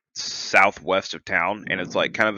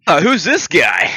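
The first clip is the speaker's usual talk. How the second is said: higher, louder, slow mock suspicion; host teasing Dennis for being away, mock suspicious; 7 semitones higher, 7 dB louder, slower than usual. Real, not acted. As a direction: rib the guest who was gone a while. Teasing the returning guest.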